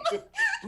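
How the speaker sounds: high-pitched burst of laughter; high squealing laugh. Real, not acted. Laughing at the joke.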